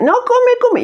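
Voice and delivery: put-on very high, insistent old grandma voice; grandma urging food. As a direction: push the plate with a shrill granny plea.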